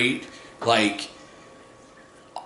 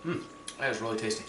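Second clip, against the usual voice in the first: talking while licking fingers; 7 dB quieter, much faster than usual. Real, not acted. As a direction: mouth on fingers, quick. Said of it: "continue to lick my fingers clean".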